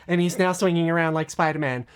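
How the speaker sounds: usual speaking voice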